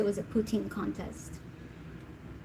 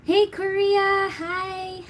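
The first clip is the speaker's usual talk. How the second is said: excited greeting; 9 semitones higher, 5 dB louder, much slower than usual. Real, not acted. Greeting viewers.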